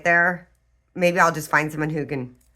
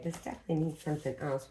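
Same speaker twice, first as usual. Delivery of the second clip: ordinary talk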